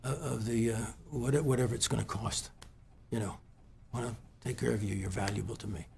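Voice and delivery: very soft and low, with emotion; close to crying. Real, not acted. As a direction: falter softly through the retold kindness. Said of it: "go through this story without crying".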